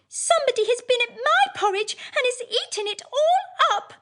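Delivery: very high, tiny squeaky bear voice; little wee bear voice. Acted. Wee bear finds his porridge gone, very high and tiny. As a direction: squeak it out like the smallest bear cub. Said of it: "in his little wee voice".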